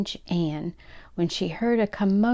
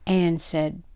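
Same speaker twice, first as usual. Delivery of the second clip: ordinary delivery, nothing marked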